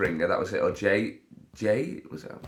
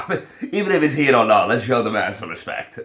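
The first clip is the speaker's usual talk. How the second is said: laughing through it; 3 semitones higher, 9 dB louder than usual. Real, not acted. Mock-solemn joke breaking into laughter.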